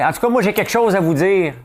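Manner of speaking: high, loud mock announcement; mock prince voice. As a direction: play it up like a teasing prince. Prince Harry quoted, teasing.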